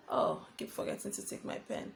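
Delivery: whispered, quick note to self; muttered aside. Real, not acted. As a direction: mutter it under your breath, barely voiced.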